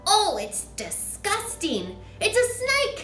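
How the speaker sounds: high, loud squeals of disgust; children shouting. A narrator's reading. Children shouting at the newt in the glass. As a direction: shriek like startled kids.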